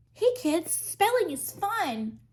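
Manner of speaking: high, slow mock of a cutesy singer; sing-song parody, dripping with mockery. Mocking the singer's cutesy line, high and slow. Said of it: "that one song she did".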